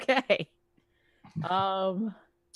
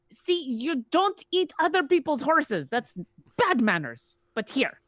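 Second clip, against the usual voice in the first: 1 scolding; 7 semitones higher than usual. Acted. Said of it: "be like".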